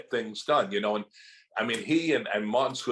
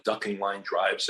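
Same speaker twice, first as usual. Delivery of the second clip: ordinary talk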